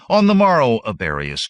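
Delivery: loud, high opening dropping on the name; commanding call. A narrator's reading. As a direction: call him to his duty. Conan calls out an order.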